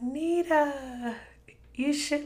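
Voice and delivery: high, drawn-out otherworldly call; transcending mother. Retold or imitated. Mom calling as she turns to light, transcending voice. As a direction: imitate a voice rising away into light. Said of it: "transcending because she was turning into this light".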